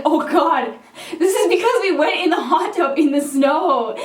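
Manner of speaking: half-laughing oh no; laughing mock panic. Speaker sees friend in hospital, laughing mock panic.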